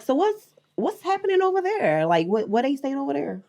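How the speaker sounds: high-pitched attempt at an American accent. Shown as a demonstration. Showing off the impression, animated American accent. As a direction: put on a lively American accent. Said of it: "uh, American accent".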